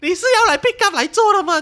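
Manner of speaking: high, loud, incredulous demand; outraged at the useless driver; indignant outburst. Retold or imitated. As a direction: fire it back in disbelief.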